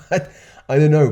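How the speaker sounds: laughing it off; a laugh through the words; amused laugh. Real, not acted. Laughing at own silly example.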